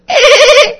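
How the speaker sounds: very high, loud dolphin squeak-laugh; dolphin chatter. Acted. Dolphin teacher looks at the student and squeals a high laugh. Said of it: "he kinda looks over at you and he goes".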